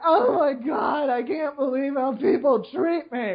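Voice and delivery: high, slow melodramatic wail; mock self-pity. Mocking a Facebook post about being treated badly, hammed-up self-pity. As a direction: ham up an overwrought complaint. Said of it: "be like".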